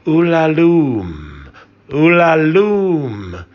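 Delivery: long, high, drawn-out wail of the name; keen the name slowly, high and loud; mournful wail. A narrator's reading. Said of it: "And she replied".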